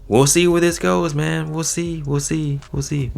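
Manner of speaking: high, lilting teasing repetition; playful high-pitched musing; teasing wait-and-see. Real, not acted. Teasing repeated sign-off on the topic.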